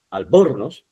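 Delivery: ALBORNOZ stressed on BOR, falling after; stress on middle syllable. Shown as a demonstration. Teacher trying a second wrong stress, BOR hit in ALBORNOZ. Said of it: "Luego, si yo digo".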